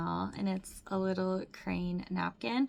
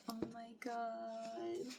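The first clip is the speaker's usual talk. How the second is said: much softer, slow, hushed awe; breathless awe; 12 dB quieter, much slower than usual. Real, not acted. Fan stunned by photo book, hushed awe. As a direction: let it out barely audible, lovestruck. Lovestruck over album.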